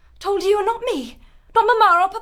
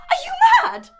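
much higher, loud, tearful outburst; furious shout; 11 semitones higher, 5 dB louder, faster than usual. Acted. Sister screaming at Melissa over the secret engagement. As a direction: shriek it at her in disbelief.

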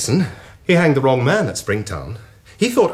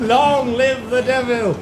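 defiant cheer; 9 semitones higher, slower than usual. Acted. Defiant toast.

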